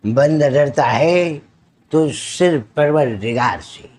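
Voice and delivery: slow, measured put-on screen voice; drawn-out dramatic declamation; film-dialogue impression. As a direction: deliver a rhymed movie line with weight. Mimicry showreel, film-style dialogue.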